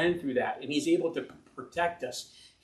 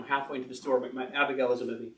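ordinary talk

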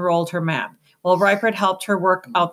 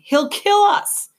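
high, drawn-out plea; urgent, pleading protest; almost pleading; 4 dB louder, slower than usual. A narrator's reading. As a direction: plead it, pitch leaping up. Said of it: "almost pleading".

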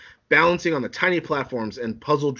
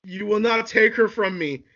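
high-pitched possessive warning; angry defiance; 7 semitones higher than usual. Acted. Anakin refuses to lose Padme, high angry defiance. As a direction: defy them, pitched up. Anakin defies Obi-Wan.

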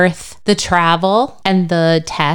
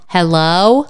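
ordinary delivery

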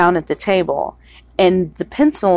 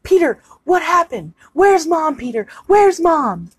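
lightly imitated voice, pitched higher; pleading questions in a higher put-on voice; Charlie; 7 semitones higher than usual. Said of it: "doing the Charlie voice".

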